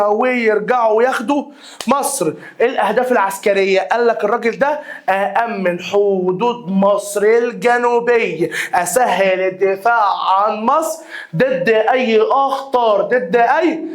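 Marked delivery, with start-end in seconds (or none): 5.1-13.8 slow, deliberate dictation pace; dictating at a slow pace. Shown as a demonstration. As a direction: spell it out slowly for the students to write. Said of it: "dictating slowly to class".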